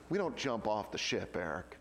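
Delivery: Navy veteran Rona correcting Eric, low and firm. Retold or imitated. Rona's flat, firm reply.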